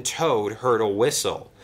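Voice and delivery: usual speaking voice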